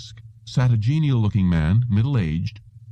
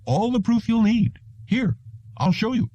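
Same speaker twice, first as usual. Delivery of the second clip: high, eager salesman voice pushing his proof; a salesman sure he's making the sale, pitched high; 13 semitones higher than usual. A narrator's reading.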